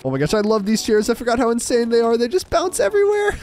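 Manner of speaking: thrilled and loud; excited. Real, not acted. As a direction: gush over the bouncy chairs. Delighted by bouncing chairs.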